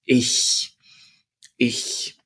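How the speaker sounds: ich with a sharp unvoiced cat hiss. Shown as a demonstration. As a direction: push air through the teeth on ich. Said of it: "And this is the hissing cat".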